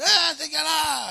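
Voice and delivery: high, loud call, drawn out; acted shout. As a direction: holler it out high and slow.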